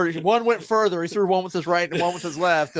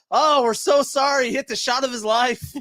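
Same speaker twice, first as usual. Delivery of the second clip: apologetic golfer; 8 semitones higher than usual. Retold or imitated. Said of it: "guy apologizing from the cart".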